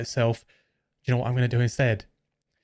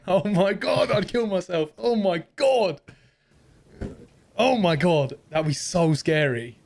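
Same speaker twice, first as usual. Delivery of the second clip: scared; 4 semitones higher, slower than usual. Real, not acted. Scared by own voice changer.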